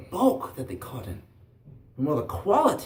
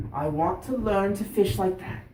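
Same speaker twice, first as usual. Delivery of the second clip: higher, louder, determined lad; insistent boy; 5 semitones higher, 6 dB louder than usual. Acted. Boy cutting off grandfather's objections, insistent. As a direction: stand your ground as a young boy.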